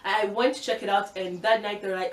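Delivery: normal talking voice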